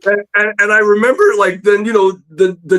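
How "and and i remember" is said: ordinary talk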